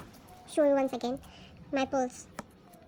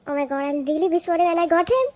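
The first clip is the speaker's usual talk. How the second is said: fast, loud rush rising at the end; real breathless delight; thrilled gushing; 5 dB louder, faster than usual. Real, not acted. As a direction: gush in disbelief at your luck. Wished-for card pulled, gushing.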